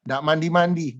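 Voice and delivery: usual speaking voice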